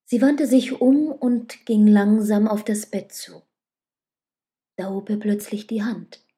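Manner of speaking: plain reading voice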